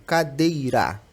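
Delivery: "cadeira" split into ca-dei-ra, each syllable stressed. Shown as a demonstration. Teacher presenting another example, "cadeira" in separate syllables. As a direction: sound out "cadeira" evenly, piece by piece.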